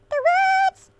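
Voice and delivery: very high, loud squeal; hammed-up high-pitched cry; squealed exclamation.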